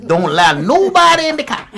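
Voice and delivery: put-on very high, loud old chauffeur voice, cracking with laughter; hammed-up polite chauffeur, laughing while he refuses; mock old driver. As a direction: squeal it out as the unbending old driver.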